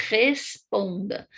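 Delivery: "responda" slowed, rasped first R; drawn-out throaty R in "responda"; strong initial R. Shown as a demonstration. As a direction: scrape the opening R of "responda". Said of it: "com o R no começo".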